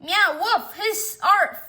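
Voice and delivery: high, loud animal words; cat and dog squabble. Acted. Cat and dog fighting, high loud yelps. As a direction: yelp out each animal noise in turn.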